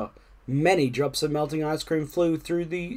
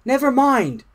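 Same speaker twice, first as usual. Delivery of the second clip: high-pitched, upbeat character voice; consoling Frog; 9 semitones higher, 5 dB louder than usual. A narrator's reading. Frog to soaked Toad, consoling. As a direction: cheer up a friend.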